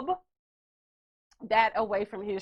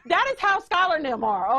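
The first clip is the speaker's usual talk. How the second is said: usual speaking voice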